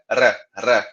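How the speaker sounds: a rolled, trembling R; trilled R. Shown as a demonstration. Teacher showing students the trilled Brazilian R. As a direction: roll the tongue on each R. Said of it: "que é um R mais trêmulo".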